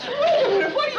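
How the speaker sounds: high, loud startled laugh. Acted. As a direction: blurt it out half laughing.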